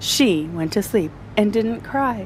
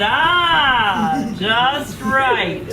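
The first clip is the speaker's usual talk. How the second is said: doctor; 3 semitones higher, much slower than usual. Acted. Doctor finds right needle.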